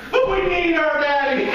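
mock cry of kids wanting daddy; shouted child plea. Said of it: "my kids walked up to you and said".

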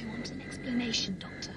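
soft urgent whisper. Acted. The mother whispering back to the Doctor in hiding. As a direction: whisper back, keeping low.

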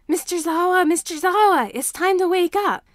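put-on high, loud little boy's voice; urgent child calling. A narrator's reading. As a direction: shake him awake, urgently. Child wakes sleeping teacher.

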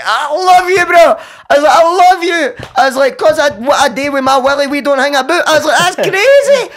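high, loud tearful confession; high emotional outpouring. Retold or imitated. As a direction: pour your heart out to the cousin. Confessing love to his cousin.

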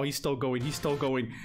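ordinary talk

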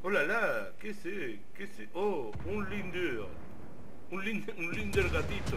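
playful fake French accent. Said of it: "tenemos que hablar con acento francés".

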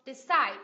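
"Decide" with a light start and loud CIDE; second-syllable stress. Shown as a demonstration. Teacher models Decide for learners, stress on the second syllable. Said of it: "nhấn vào âm tiết thứ".

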